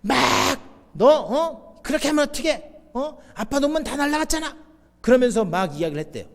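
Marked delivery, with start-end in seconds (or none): 0.9-4.6 put-on high-pitched, heated scolding of a toddler; padre che sgrida. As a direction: act out an exasperated dad telling off his child. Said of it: "혼내고 있는데 그 아이가".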